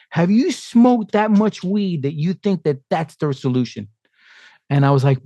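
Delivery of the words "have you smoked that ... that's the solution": higher, scornful mom voice; mock the mother's disbelief; lightly voiced scolding mother; incredulous mother. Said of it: "she literally said".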